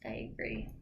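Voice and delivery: soft tired whisper. Real, not acted. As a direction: whisper it back quietly. Late night, whispering back.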